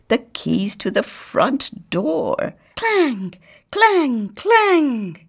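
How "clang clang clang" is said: high, loud clang. Acted. Sir Stallmeyer hunting for keys, loud high clangs. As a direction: bang it out like metal.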